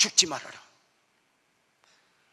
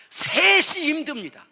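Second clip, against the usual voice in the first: emphatic punchline; 11 semitones higher, 9 dB louder, slower than usual. Retold or imitated. Member's punchline, three is hard.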